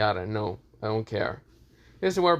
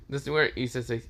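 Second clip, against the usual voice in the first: usual speaking voice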